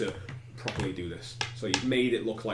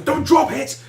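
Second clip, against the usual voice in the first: panicked shout; 12 dB louder, slower than usual. Acted. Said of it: "another one goes".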